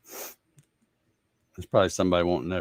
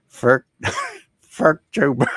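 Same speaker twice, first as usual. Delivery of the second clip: Swedish chef voice; 7 semitones higher, 8 dB louder than usual. Retold or imitated. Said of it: "Muppet Swedish chef saying".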